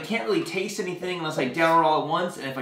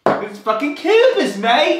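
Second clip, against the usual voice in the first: put-on Australian accent; 10 semitones higher, 8 dB louder, slower than usual. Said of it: "as [name]'s Australian accent".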